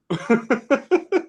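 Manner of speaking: imitating a shocked high gasp; high startled intake of breath; mock gasp. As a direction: gasp like a caught kid. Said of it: "his face just went".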